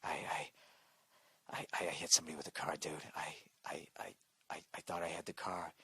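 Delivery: hushed, frightened stammer into the phone; scared whisper. Retold or imitated. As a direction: whisper it like a terrified buddy leaving a phone message. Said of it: "He's whispering. That's how fucking scared he is".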